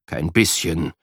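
usual speaking voice

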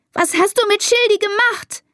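very high-pitched upset cry; accusing scream. A narrator's reading. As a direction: shriek the accusation at your sister. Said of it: "schreit sie".

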